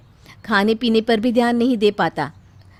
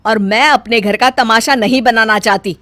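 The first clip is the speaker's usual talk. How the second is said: loud, firm scolding; domineering mother-in-law lecture; 2 semitones higher, 8 dB louder than usual. Acted. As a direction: lay down the house rules.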